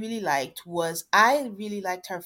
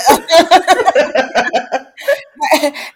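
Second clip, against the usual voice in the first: loud open laugh, high; really cracking up at it; a loud laugh that rises and falls; burst of laughter; 5 semitones higher, 9 dB louder than usual. Real, not acted. Laughing at uptight girl.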